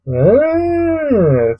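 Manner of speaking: ordinary delivery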